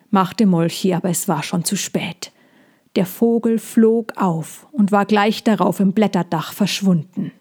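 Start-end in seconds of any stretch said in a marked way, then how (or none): none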